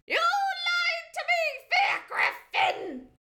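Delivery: high, slow crying shout; betrayed and heartbroken. Acted. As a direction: wail it out in betrayal. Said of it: "she cried".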